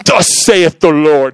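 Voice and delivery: slow, solemn mock-prophetic proclamation. Joking to the crowd about how he opened to the lady, mock-solemn prophet voice. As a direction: intone it like an old-time prophet, tongue in cheek. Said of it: "No, I'm just kidding".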